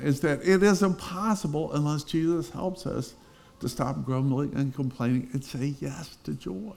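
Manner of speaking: ordinary talk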